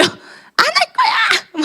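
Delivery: put-on high, slow laughing squeal of a child; mother imitates her toddler refusing, high squeal; toddler yelling no. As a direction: play the stubborn two-year-old screaming no. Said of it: "막 소리 지르고".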